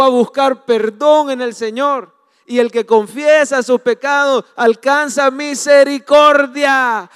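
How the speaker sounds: loud, slow fervent preaching; full force preaching. Acted. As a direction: preach it out to the whole church.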